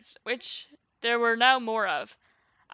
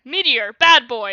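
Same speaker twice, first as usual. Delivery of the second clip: mild yell, louder; scolding yell; 8 dB louder than usual. A narrator's reading. Ruby yelling at Meteor, scolding. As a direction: scold a naughty child loudly. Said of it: "yelled Ruby".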